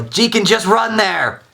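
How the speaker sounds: high, loud holler with stretched words; yell. Acted. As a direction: holler urgently after a partner. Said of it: "I yell".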